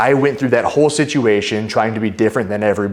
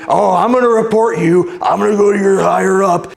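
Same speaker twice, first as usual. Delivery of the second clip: high-pitched mocking complainer voice; imitating shouting woman; 11 semitones higher, 4 dB louder than usual. Angry traveler threatening to report airport worker, mock shrill shouting. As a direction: mimic her indignant threat, much higher. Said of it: "she was shouting at him".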